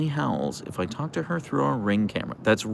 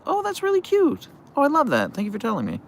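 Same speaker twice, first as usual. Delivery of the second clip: much higher, squealing delight; high gushing coo; faster than usual. Real, not acted. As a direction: coo it like a thrilled fan. Gushing reply to a viewer.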